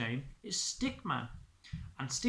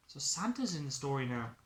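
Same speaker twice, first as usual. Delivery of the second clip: plain reading voice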